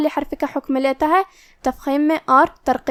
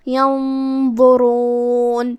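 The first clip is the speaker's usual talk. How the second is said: very slow, long held notes in tajweed chant; teacher's slow melodic recitation; Quran recitation; much slower than usual. Shown as a demonstration. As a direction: intone the verse, stretching every vowel. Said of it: "چھپاتے ہوئے لیکن غنہ کے ساتھ".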